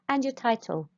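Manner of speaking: ordinary talk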